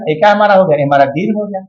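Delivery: ordinary talk, nothing marked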